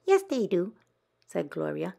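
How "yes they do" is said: sister correcting. Acted. Sister Gloria contradicts Frances.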